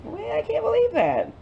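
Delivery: high-pitched disbelief; amazed. Real, not acted. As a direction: squeal in happy disbelief. Amazed at the cut soap.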